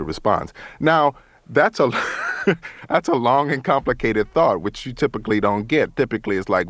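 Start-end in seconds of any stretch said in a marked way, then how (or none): none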